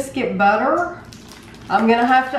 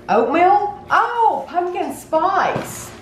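pleasantly surprised; 8 semitones higher, much slower than usual. Real, not acted. Opening surprise box item.

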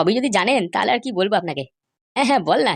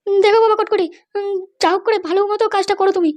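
much higher-pitched woman's voice; a high female character voice; mother's voice; 9 semitones higher than usual. Acted. Mother pleads with Kotkoti.

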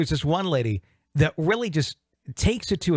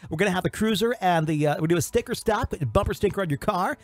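high, sing-song DJ hype; cheesy radio voice; 7 semitones higher than usual. Retold or imitated. DJ promoting the party van, high sing-song hype. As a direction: oversell it like a small-town DJ. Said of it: "I should talk in radio voice".

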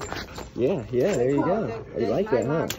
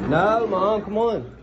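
whiny protest; 9 semitones higher, 6 dB louder, slower than usual. Real, not acted. Kid whining at mother in store.

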